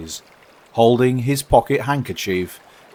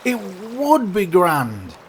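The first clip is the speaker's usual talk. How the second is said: wistful character voice; 9 semitones higher than usual. Acted. Walrus and carpenter voiced.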